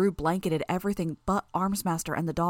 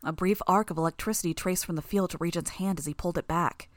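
ordinary delivery

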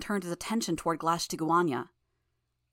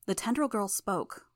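ordinary delivery, nothing marked

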